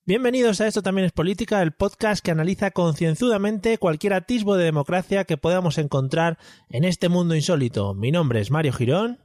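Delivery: upbeat announcer-style opening; podcast intro. Acted. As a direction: present the show brightly and fast. (presentador de pódcast animado)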